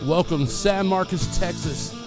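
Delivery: usual speaking voice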